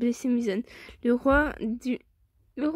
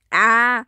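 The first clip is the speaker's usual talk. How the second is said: loud cry of frustration; sudden exasperated "ah"; frustrated yell; 8 dB louder than usual. Real, not acted. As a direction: burst out loudly at your own slip. Frustrated after fluffing a word.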